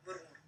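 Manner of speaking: "murmur" said very softly, drawn out; savoured word. Shown as a demonstration. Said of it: "I love this word".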